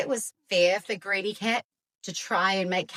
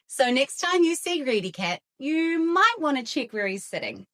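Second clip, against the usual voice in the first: usual speaking voice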